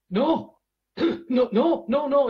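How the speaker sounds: high-pitched, mock-panicked denial; imitating a mate caught out; flustered backtracking. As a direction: stammer out a flustered retreat, acted. Acting mate's panicked backtracking.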